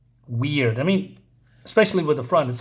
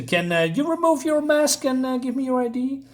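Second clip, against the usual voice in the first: high put-on security guard voice; much higher mock guard voice; 9 semitones higher than usual. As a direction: play a squeaky rent-a-cop demanding ID.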